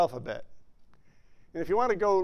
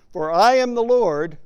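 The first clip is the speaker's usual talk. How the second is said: proclaiming scripture; 4 semitones higher, 6 dB louder, slower than usual. Retold or imitated. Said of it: "he says".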